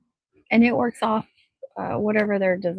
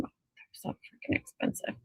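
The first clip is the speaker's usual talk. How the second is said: much softer whisper; whispered aside; 14 dB quieter than usual. Real, not acted. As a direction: drop to a conspiratorial whisper. Whispered aside about price.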